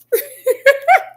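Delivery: shrieking cackle of laughter; high squealing laugh. Real, not acted. Laughing at the ten dollars.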